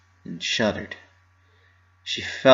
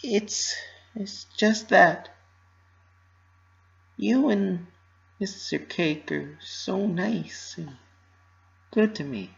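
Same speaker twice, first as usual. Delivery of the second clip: hesitant Pinky; 10 semitones higher, slower than usual. Acted.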